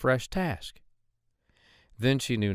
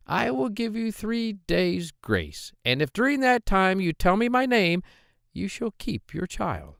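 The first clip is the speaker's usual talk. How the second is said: higher, squeaky voice of a hobbling little man; droll little man; 10 semitones higher than usual. Acted. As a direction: pitch him up high and sly. Dwarf grants three days.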